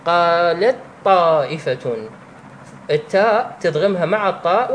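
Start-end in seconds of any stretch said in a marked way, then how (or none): 0.1-2.0 slow, merged "قالطّائفة". Shown as a demonstration. Teacher showing idgham of ta into the emphatic ta, slow. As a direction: say "قالت طائفة" slowly with the ta of "قالت" absorbed into a doubled ط, "قالطّائفة". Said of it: "تقول هكذا".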